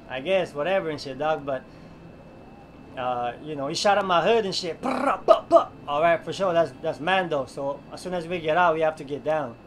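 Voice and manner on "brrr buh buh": loud, high mouth gunfire; gunshot noise. Retold or imitated. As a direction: spit out a burst of gunfire sounds.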